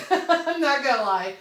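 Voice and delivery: laughing through the words at an ugly design. Real, not acted. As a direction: giggle it out while admitting it.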